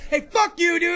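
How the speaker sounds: high, loud bar taunt; hammed-up fight start; mock angry yell. Acted bar fight between fans.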